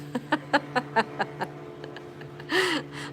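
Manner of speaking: high, long burst of laughter. Real, not acted. Streamer amused by Paula's chat message, laughing long.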